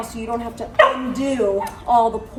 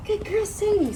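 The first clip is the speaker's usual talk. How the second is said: higher sing-song praise, falling at the end; praising Sadie again; 9 semitones higher than usual. Real, not acted. Trainer praising Sadie again, high praise.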